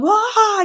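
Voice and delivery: mock startled yelp, high and loud; scared gasp. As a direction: yelp like a scared fourteen-year-old. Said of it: "all fucking scared".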